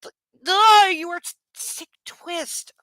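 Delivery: gag at the character; disgusted. Real, not acted. Reader disgusted by the hero, revolted groan then muttered insult.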